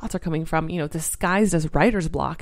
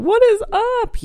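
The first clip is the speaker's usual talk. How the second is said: much higher, slow squealed greeting; excited squeal; 4 dB louder, slower than usual. Acted. Host greets her listeners with a high, slow squeal. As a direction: squeal it out like a cheer.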